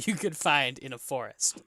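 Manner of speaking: ordinary talk, nothing marked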